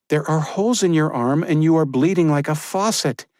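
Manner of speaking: horrified at the wound; alarmed Josephine. Acted. Josephine seeing Crowley's bleeding arm, alarmed.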